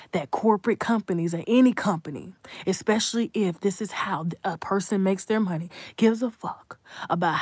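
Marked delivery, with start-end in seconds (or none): 1.7-5.7 unvoiced whispered aside; conspiratorial whisper. Real, not acted. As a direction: drop to a hushed whisper to the viewers. Aside hissed to viewers.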